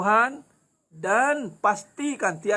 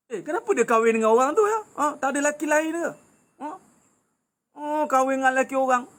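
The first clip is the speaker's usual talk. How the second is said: higher, mock-indignant busybody voice; angry gossiping woman; 4 semitones higher, faster than usual. Preacher mimicking a woman angry at another's marriage, mock-indignant and higher. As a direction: act out a woman griping about someone else's marriage. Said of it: "Marah dengan jodoh orang".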